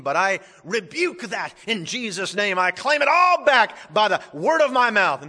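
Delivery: loud, high declaiming, hammed-up; mock prosperity preacher. Preacher parodies what Job didn't say, hammed-up triumphant declaring. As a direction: parody a claim-it-back faith preacher at full volume. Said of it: "a phony prosperity, health, and wealth gospel".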